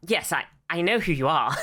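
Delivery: normal talking voice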